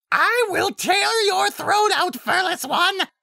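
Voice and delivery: slow, loud, very high monster scream; furious, drawn-out high roar; enraged threat. Acted. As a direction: scream murder like a trapped beast. Alpha enraged by the pun.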